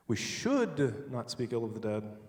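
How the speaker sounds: a long, slow should, rest trailing down; stress on should. Shown as a demonstration. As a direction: lean hard on the word should. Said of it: "emphasize a different word each time".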